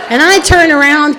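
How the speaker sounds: usual speaking voice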